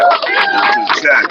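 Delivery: very high, slow falsetto greeting; high silly voice. Acted. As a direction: stretch it out in a squeaky high voice. Repeats greeting in put-on high voice.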